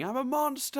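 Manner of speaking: high-pitched cartoon cry of horror; mock yell. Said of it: "he's yelling".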